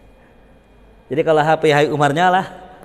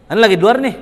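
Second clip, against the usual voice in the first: "Ane lagi di luar nih" higher and louder, stressing "luar"; higher and louder "luar" snapped out; different intonation; 6 semitones higher, 6 dB louder, faster than usual. Shown as a demonstration. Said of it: "Kan beda, intonasi beda".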